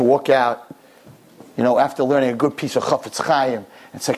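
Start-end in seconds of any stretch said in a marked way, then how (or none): none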